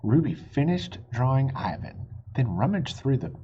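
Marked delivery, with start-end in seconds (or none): none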